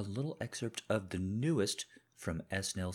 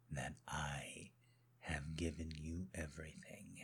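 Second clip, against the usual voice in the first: much softer whispered reproach; reproachful whisper; 4 semitones lower, 12 dB quieter than usual. Acted. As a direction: whisper it, barely voiced. Husband's quiet reproach.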